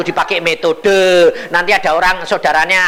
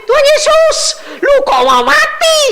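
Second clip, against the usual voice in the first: panicked outcry; 5 dB louder than usual. Retold or imitated. Uncle crying out to Jesus.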